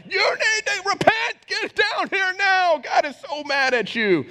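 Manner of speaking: mock hellfire preacher yelling at the congregation. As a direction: imitate a screaming preacher calling you to repent. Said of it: "preaching like he lived in hell".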